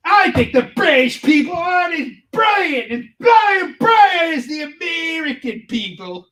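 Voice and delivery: high, loud, shouted boast; hammed-up dumb American. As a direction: yell it like a proud idiot.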